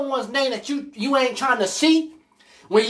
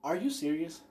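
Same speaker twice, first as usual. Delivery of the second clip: incredulous aside; 8 semitones lower, 14 dB quieter than usual. Retold or imitated. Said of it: "I looked at her like".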